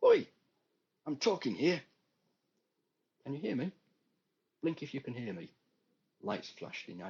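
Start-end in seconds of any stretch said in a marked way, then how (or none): 0.0-1.8 higher, louder angry shout; angry yell. Acted. Frustrated patient yelling. 3.2-5.5 doctor testing patient. Acted.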